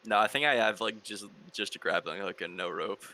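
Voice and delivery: ordinary talk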